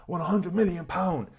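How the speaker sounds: high, giddy lottery-win cry; mock excited. To the viewer, imagining a lottery win: high giddy cry. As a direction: act over the moon at winning. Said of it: "running around your house like".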